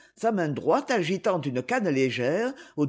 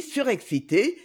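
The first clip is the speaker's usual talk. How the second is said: usual speaking voice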